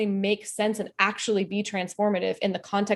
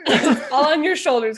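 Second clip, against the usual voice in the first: laughing through the words, a bit louder; laughing tease; 5 semitones higher, 6 dB louder than usual. Real, not acted. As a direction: tease him while still laughing. Teasing co-host through laughter.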